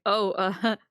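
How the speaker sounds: awkward fake laugh; playing along. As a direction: laugh along as if you get the joke. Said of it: "tries to play along like".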